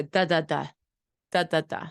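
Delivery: "Da, da, da" in place of "all done"; repeated syllable. Shown as a demonstration. Imitating a child's reduplicated "all done". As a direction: bounce the same "da" over and over. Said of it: "repeat the syllable".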